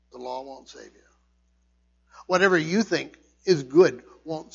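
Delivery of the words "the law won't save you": quiet earnest echo; soft repeat. Real, not acted. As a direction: drop to a hushed echo. Repeated softer for effect.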